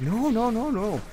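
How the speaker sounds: quick high-pitched string of no's; insistent protest. Retold or imitated. Friend waving off the disgust, insistent protest. Friend rebuts his "nasty" remark.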